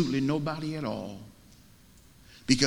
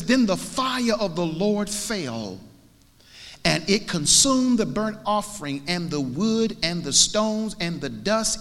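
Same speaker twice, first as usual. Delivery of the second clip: raised, rolling pulpit reading; declaimed scripture; 6 semitones higher, 3 dB louder, slower than usual. Acted. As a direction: proclaim the verse with lifted voice.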